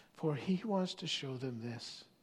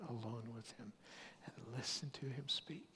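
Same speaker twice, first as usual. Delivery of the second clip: whisper; 6 semitones lower, 13 dB quieter than usual. Acted. Walking alone with God, intimate.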